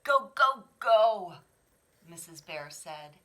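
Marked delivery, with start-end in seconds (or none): 0.0-1.4 cheering mother. Acted.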